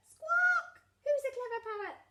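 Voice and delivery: very high squawking parrot voice; parrot mimicry. A narrator's reading. Parrot copies Granny Pig's praise, very high squawky mimicry. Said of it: "Polly copied".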